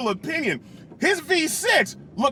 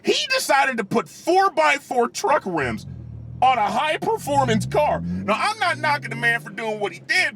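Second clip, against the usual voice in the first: ordinary talk, nothing marked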